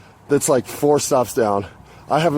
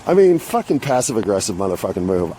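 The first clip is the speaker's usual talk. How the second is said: normal talking voice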